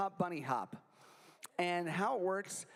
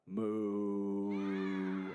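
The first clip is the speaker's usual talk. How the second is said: low long mooing; cow moo; 8 semitones lower than usual. Pretend. Pony song, wrong sounds for kids.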